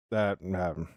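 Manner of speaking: normal talking voice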